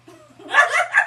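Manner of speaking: loud high laugh bursting out; burst of laughter. Real, not acted. Hearing her friend say professionally, real laugh. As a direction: crack up at the claim.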